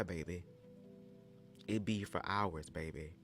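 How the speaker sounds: usual speaking voice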